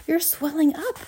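high-pitched panicked cry; horrified scream. A narrator's reading. Mother watches Violet keep swelling, horrified scream. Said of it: "screamed Missus Beauregard again".

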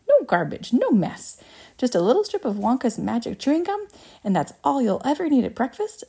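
high-pitched, rapturous sales pitch; eager showman reeling off marvels; excited inventor, enthusiastic. Acted. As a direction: sell it with rising zeal, punching each item. Voices Wonka pitching his gum.

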